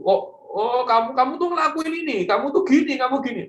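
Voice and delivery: high, rapid scolding lecture; nagging mentor. Retold or imitated. Mentor scolding a youth about his faults, high nagging voice. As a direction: play the mentor nagging you, pitched high. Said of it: "waktu kalian sedang digas sama Coffee J".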